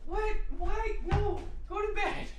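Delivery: very high, squeaky protest; squealed disbelief. Real, not acted. Streamer telling a late-night viewer to sleep, squealed disbelief. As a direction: squeak out each outraged word separately.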